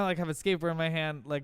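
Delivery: ordinary delivery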